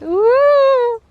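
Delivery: high, loud squeal of real nerves; nervous squeal. Real, not acted. Trying the new garden swing for the first time, nervous squeal. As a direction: squeal it out as you sit down. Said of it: "I'm scared".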